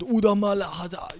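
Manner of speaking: high-pitched, exaggerated African-accent gibberish; mock African accent. Critic accusing the fighter of faking it, mocking African accent. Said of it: "when you put on that fucking accent".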